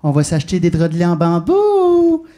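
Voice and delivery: slow, loud, insistent urging. Acted. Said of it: "elle revient à la charge un peu plus fort".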